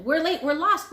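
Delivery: high loud cry of late and lost; yelling. A narrator's reading. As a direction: call out frantic.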